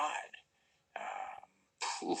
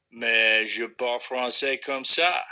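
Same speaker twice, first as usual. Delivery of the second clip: slow, loud French; 9 dB louder, slower than usual. Shown as a demonstration. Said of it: "how come you speak French".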